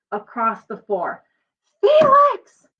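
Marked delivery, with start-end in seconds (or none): 1.8-2.7 very high, loud angry yell of his name. Acted. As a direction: mom yelling at Felix. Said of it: "yelled his mom".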